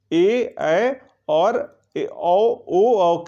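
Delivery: each vowel drawn out very slowly; slow dictation. Shown as a demonstration. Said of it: "teacher dictating vowel list". (स्वरों को बहुत धीरे-धीरे बोलना)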